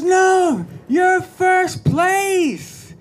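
high, loud and very slow; excited announcement. Retold or imitated. Coach telling the girl she came first, high, loud and slow. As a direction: stretch out the good news.

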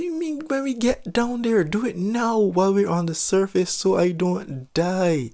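high-pitched panicky protest, hammed up; mock freaking out. Nervous diver pleading with instructor at the surface, mock freaking out. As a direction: play a panicked demand, pitch way up. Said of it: "Stop freaking out".

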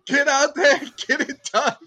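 high, loud rallying shout; rousing mock locker-room oratory; mock coach speech. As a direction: belt it out like a hammed-up pep talk.

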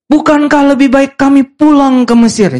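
high-pitched indignant question; mock grumbling. Israelites question going on, high mock grumbling. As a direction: whine it as a hammed-up protest.

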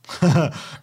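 short real chuckle; real laugh. Real, not acted. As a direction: laugh at the poor guy. Laughs at OP's mistake.

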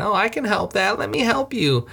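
lightly voiced fussy old lady; a hint of nagging helpful ladies. Lucille Ball lookalikes nagging the driver, a light fussy lady voice. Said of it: "nagging him".

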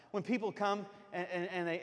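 ordinary talk

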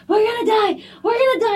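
high frantic yelling in panic; panicked screaming. Retold or imitated. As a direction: scream it like you're sure you'll die. Retelling a panicked freakout.

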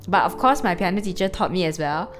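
ordinary talk, nothing marked

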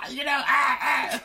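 high hyperventilating yelps; hammed-up gasping squeals; mock conniption shrieks. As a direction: squeal in short comic bursts. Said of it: "she had a conniption".

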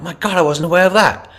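high, loud disbelief; acted-out astonished outburst; mock shock. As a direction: exclaim as an executive caught unawares. Acting shocked executives' reaction.

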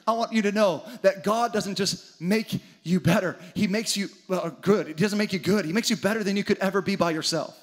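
usual speaking voice

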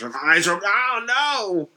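hammed-up wail climbing very high; mock panic. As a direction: play-act horrified distress, voice shooting up. Mock Clockwork Orange eye-torture scene.

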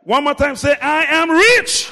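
loud, high, drawn-out declaration; rallying shout. Shown as a demonstration. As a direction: whip the crowd into shouting it.

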